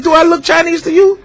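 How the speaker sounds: high, disbelieving question; incredulous challenge. Retold or imitated. Uncle challenging the customer, high and incredulous. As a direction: dare him to say it. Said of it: "He said".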